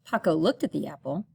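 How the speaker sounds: ordinary delivery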